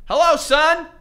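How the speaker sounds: high, loud, shaky impression of a dad; Tom Hanks. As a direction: put on a quavering fatherly greeting. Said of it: "doing my Tom Hanks impression".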